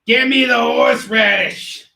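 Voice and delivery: loud, high-pitched comic demands. Acted.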